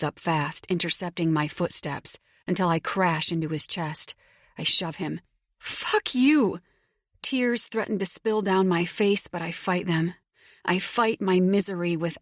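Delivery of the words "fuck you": high, angry cry of betrayal; furious outburst. A narrator's reading. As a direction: hurl it at him. She shoves him, furious.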